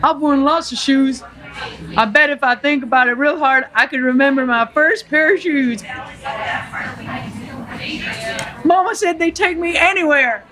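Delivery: wistful Forrest Gump, lightly done; Forrest Gump accent. Acted. Forrest remembering his first shoes, light Southern accent. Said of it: "Do the accent".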